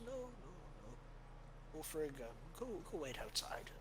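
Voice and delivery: put-on soft, high old cleric sending someone away; a kindly elder waving off a guard; gentle old priest. Father dismissing Ulfrig gently, softly and kindly.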